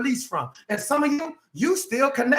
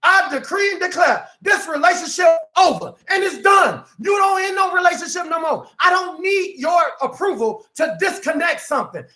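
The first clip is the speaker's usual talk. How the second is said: shouted preacher's decree, higher; preaching declaration; 6 semitones higher than usual. Acted. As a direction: declare it over with full force. Preacher declaring in full force.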